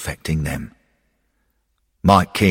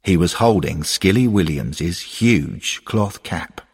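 plain reading voice

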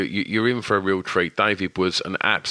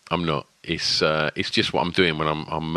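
ordinary talk

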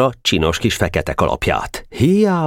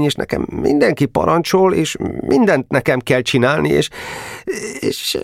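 higher, shaky plea near tears; small private pleading with his captain, trembling; 8 semitones higher than usual. Acted. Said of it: "remegő hangon így szólt".

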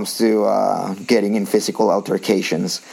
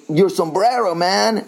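imitating the stranger; 9 semitones higher, slower than usual. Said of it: "He goes".

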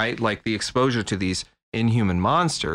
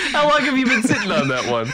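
laughing; 14 semitones higher, 5 dB louder, faster than usual. Real, not acted. Laughing at the pun.

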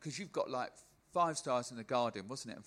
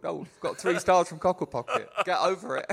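higher, louder mock-proud brag; mock smug boast; 6 semitones higher, 8 dB louder than usual. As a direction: puff up like a vain act sneering at critics. Mocking a smug reviewed comedian.

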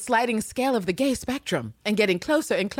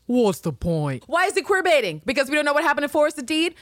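ordinary talk

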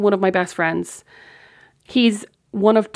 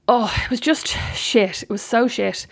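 ordinary delivery

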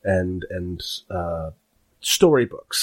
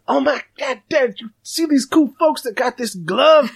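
put-on much higher, loud, breathless child voice; excited kid; 3 dB louder than usual. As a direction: gush to dad like a thrilled little kid. Kid boasting to dad.

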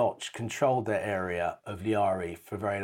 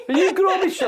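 high woman's voice; 8 dB louder than usual. Retold or imitated. Woman in a tuk-tuk calls to the actor, a high loud squeal. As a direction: squeal it out like a starstruck fan. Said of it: "this voice just goes to me".

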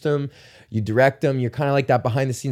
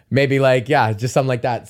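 ordinary delivery

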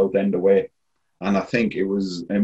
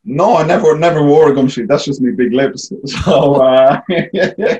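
loud, high, laughing denial; 7 semitones higher, 8 dB louder than usual. Real, not acted. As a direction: laugh through the no, amused. Laughing denial about gum shield.

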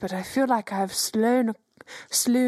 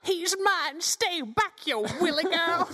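higher, softer rival fan in the crowd; jealous fan; 7 semitones higher, 4 dB quieter, slower than usual. Acted. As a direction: fend off a rival admirer, pitched high. Player joins as rival fan.

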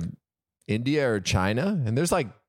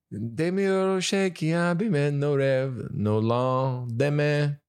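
slow, bad French accent on "no lendemain"; terrible French accent; 4 semitones higher, much slower than usual. Shown as a demonstration. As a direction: mangle the French lyric slowly. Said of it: "still a terrible French accent".

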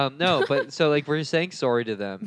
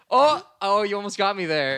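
mock Australian, higher and louder, caught out; Aussie bird busted, a bit higher; 7 semitones higher, 6 dB louder than usual. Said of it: "And he was like".

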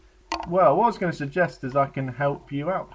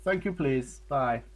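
vague Eastern European mock accent; put-on foreign accent; slower than usual. Interviewer ending the mock phone call, put-on foreign accent. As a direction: sign off in a fake foreign accent. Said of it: "what race I was doing".

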